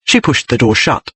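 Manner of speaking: plain reading voice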